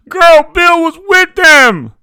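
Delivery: high, drawn-out scream to a friend; frantic call. A narrator's reading. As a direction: scream frantically to the woman next door. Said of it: "called frantically to her friend".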